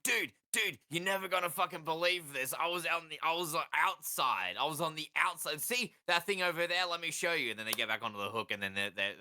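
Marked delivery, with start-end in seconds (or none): 0.0-7.5 high, urgent excited fish telling friends; breathless tall tale to your mates, pitched up. Acted. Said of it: "They go to all their fish friends and like".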